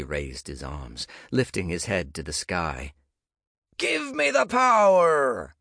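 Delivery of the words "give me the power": much higher, louder, slow theatrical plea to the sky; mock dramatic cry. Nick raising his arms to the sky, mock dramatic cry. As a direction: beg the sky like a ham villain. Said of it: "Nick's mock dramatic cry".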